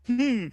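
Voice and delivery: strained hum. Acted. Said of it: "closes his mouth and goes".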